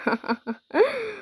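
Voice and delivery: a short high laugh, soft; amused laugh. A narrator's reading. Rahul teasing nervous Namita, high soft laugh. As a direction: laugh off her worry.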